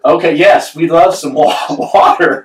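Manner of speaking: acted eager reply to a waitress; relieved understanding. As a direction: accept it as if the penny has finally dropped. Acting out finally understanding the waitress.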